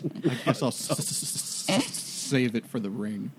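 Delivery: low, halting stammer on the s; stammering character. Acted. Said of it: "And he goes".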